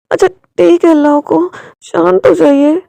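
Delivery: Wing pleading with husband to calm down, loud; placating. Acted. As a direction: settle him down, slowly. Wing calms the angry husband.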